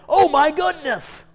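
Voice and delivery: high, slow, loud oh my goodness; hammed-up oh my goodness; mock surprise. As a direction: gasp it out like a shocked aunt. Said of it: "the Jehovah Witness, like".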